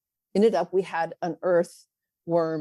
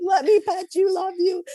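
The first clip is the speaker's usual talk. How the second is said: put-on high, eager little girl voice; 10 semitones higher, 4 dB louder than usual. Teacher voicing a tiny pet-loving girl, high and eager. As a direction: coo like a small child begging to pet.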